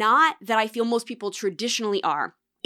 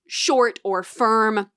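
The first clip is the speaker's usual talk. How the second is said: usual speaking voice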